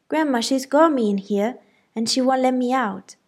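high, loud whining yell. A narrator's reading. Boy yelling for his grandma from the kitchen, high loud whine.

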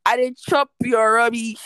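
loud Nigerian Pidgin jab. Real, not acted. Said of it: "I'm going to say it in pidgin".

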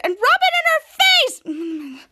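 high, slow, loud gloat; gleeful gloating. Retold or imitated. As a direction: savour every word of the taunt. Piper gleeful at the rival.

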